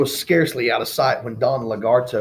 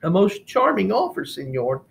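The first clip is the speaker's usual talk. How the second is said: dry; 9 semitones higher than usual. Acted. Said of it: "she said dryly".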